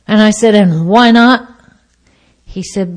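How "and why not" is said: loud acted-out comeback; defiant challenge. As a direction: challenge the waiter loudly.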